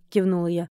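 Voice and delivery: ordinary delivery, nothing marked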